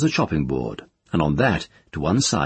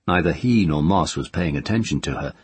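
ordinary delivery

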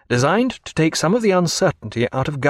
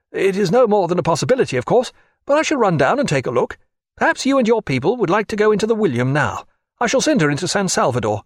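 a touch higher, brisk captain's voice; lightly voiced captain; 8 semitones higher, faster than usual. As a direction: calmly give the captain's orders. Captain Lambert to Jack.

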